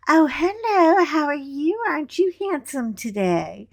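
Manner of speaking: flirty imitation. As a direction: tease her by playing a coy flirt. Imitating her flirting with gas attendant.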